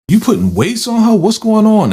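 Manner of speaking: the cop suddenly catching on; a retold cop's high-pitched alarm. Cop catches weights on her.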